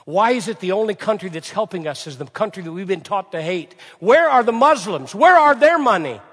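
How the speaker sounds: rising to a loud, high outcry; voicing Sri Lankan critics. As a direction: demand it indignantly. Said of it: "They were saying".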